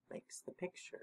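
soft whisper; whispered answer. Real, not acted. Reader quietly answering his question for the children, whispered. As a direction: whisper the answer like a helpful hint.

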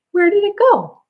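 high, excited where did it go; playful surprise. Shown as a demonstration. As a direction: act amazed the scarf vanished. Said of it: "we're gonna hide it behind our back and say".

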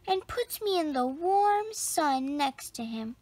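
plain reading voice

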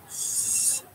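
long hissed "S" sound; drawn-out s like a snake. Shown as a demonstration. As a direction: hiss "S" out like a snake. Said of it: "Makes you sound like a snake".

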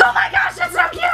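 imitating her own excited shriek; playful self-imitation of a squeal; mock squeal. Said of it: "how I feel when I'm like".

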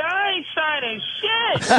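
high, slow defiant refusal; mock defiant refusal to the officers. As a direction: dig in and refuse to sign.

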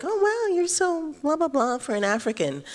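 high-pitched mimicry of patronising praise; mock amazed onlooker. Artist imitating people who judge her Africanness, high mock amazement. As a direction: put on high, gushing mock amazement, easing down. Mimicking others' backhanded compliments.

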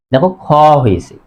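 ordinary delivery

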